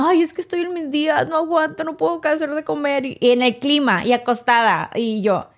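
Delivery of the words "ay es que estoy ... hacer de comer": high, whiny put-on complaint; imitating whining friend. Quoting the friend who cried about her period in a high, mock whine. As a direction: mimic a whimpering, self-pitying friend. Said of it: "Ella lloraba".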